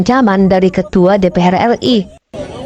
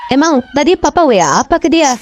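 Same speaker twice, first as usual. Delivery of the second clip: demanding shout; 7 semitones higher than usual. Acted. Husband demanding on phone.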